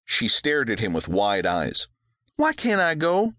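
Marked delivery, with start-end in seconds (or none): 2.4-3.3 put-on high girl's plea; little girl whining, higher; pleading girl. A narrator's reading. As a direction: daughter begging to go along.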